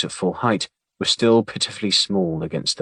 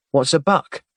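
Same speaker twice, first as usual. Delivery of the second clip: put-on little girl asking; 7 semitones higher, 3 dB louder than usual. A narrator's reading. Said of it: "asked the little girl".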